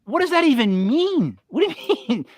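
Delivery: high-pitched, incredulous retold question; mock bafflement. Host retelling reaction to a Southern saying, high mock bafflement. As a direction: act out being utterly baffled.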